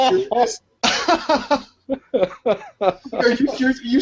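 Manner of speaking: high, disbelieving "serious"; can't believe what she's doing; incredulous. Retold or imitated. Incredulous at boss's letter.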